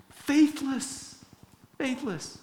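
high, scolding at himself; self-mocking. Real, not acted. Preacher mocking his own faithless prayer, high. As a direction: tease your own doubt.

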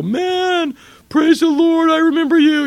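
overjoyed convert. Acted. The former scoffer, now converted, greets the believer years later, ecstatic and loud. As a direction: burst out like a long-lost friend overflowing with joy.

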